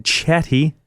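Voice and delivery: slow, clear on the code; stressed promo code. Shown as a demonstration. Host reading the promo code slowly, stressing Chatty. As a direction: lean on the word Chatty slowly. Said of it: "with the promo".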